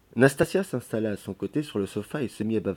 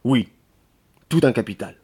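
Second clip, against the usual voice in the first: louder, decided reply; firm; 7 dB louder than usual. A narrator's reading. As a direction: state it firmly after a pause. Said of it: "répondit-il fermement après un silence".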